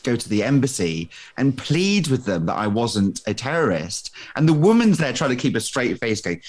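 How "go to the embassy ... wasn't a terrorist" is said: ordinary talk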